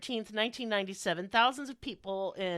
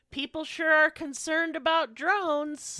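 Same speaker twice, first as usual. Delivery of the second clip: mock shrug; 6 semitones higher, 4 dB louder than usual. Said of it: "shrugging and saying things like".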